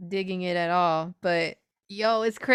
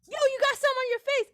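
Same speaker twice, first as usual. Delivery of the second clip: high, fast, teasing announcement; mock call-out; faster than usual. Mocking a public call-out.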